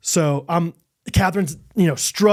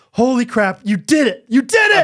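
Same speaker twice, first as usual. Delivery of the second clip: high, loud, rising joy; elated praise; 6 semitones higher, 4 dB louder than usual. Retold or imitated. Husband praising wife after birth, high and loud. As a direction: burst with pride at her. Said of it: "and, like".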